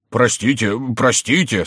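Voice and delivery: lightly voiced pleading king; imitating the king. A narrator's reading. Jester begs pardon in the king's stead, mimicking him. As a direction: beg as if mimicking a frightened monarch. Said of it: "подражая голосу короля".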